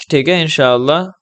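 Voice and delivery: ordinary talk, nothing marked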